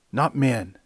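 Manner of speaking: normal talking voice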